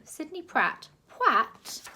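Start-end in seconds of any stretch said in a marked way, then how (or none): 1.1-1.9 high, loud, fussy posh voice; a plummy official begging for order; posh gentleman. A narrator's reading. Posh zoo director pleading with the crowd, loud and high.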